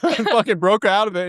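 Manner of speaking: ordinary delivery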